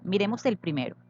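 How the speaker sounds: normal talking voice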